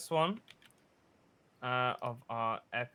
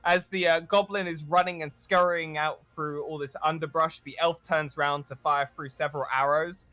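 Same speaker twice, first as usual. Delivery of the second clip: game master painting a chase scene; dramatic scene narration; 4 semitones higher, 11 dB louder than usual. Acted. Game master setting the scene for players, cinematic narration. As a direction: narrate it like a movie trailer.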